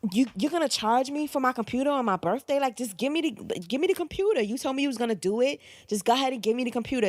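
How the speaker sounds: high, rising, pushy argument; retold heated demand. To her father at the gas station, in a high heated demand. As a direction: badger him for the computer.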